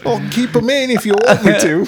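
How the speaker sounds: mock Cockney. Playing along in Cockney.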